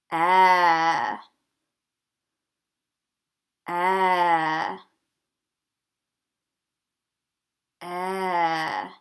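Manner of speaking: isolated Eh, short open vowel, held briefly; teacher sounds out Eh slowly for the children. Shown as a demonstration. Teacher models the letter sound for young learners, drawn out. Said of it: "This is our letter E".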